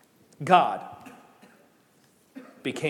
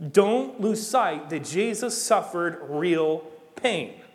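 slow, emphatic; much slower than usual. Acted. Preacher slows for emphasis.

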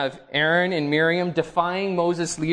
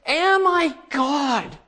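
shouting; 10 semitones higher, 3 dB louder, slower than usual. Retold or imitated. King of Israel cries out.

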